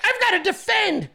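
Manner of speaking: high-pitched mocking cry; high, exaggerated quote of a defender; mock eager defender. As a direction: leap in like an overeager rescuer, mockingly.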